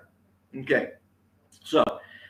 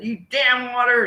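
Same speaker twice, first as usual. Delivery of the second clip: high, raised-voice scolding; mild mock yell, pitched up; mock scream; 9 semitones higher, 4 dB louder than usual. Speaker acts out screaming at the water, mock scream. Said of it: "you scream at it".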